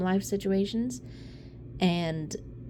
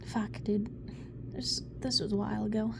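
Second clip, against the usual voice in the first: choked-up whisper; 10 dB quieter than usual. Real, not acted. Overcome, then apologises "Sorry.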